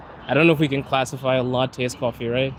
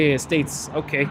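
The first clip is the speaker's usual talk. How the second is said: usual speaking voice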